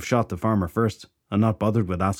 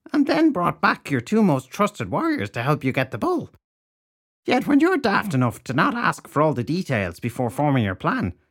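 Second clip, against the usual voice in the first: indignant crone; 6 semitones higher than usual. Acted. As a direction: scold him as the crone.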